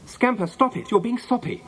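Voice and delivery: high-pitched exasperated telling-off; scolding the dog. A narrator's reading. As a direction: tell off a soppy pet, pitched high. Janet scolds her dog.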